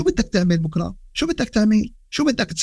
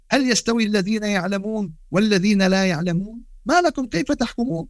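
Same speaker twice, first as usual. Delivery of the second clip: Quranic recitation; 4 semitones higher than usual. Acted. Quoting a Quran verse.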